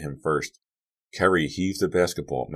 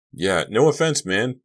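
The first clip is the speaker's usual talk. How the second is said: higher, louder eager agreement; echoing buddies; 7 semitones higher, 6 dB louder than usual. A narrator's reading. Bugner and Henderson echoing Malik, eagerly agreeable. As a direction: chime in right after someone's apology. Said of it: "Bugner and Henderson echoed".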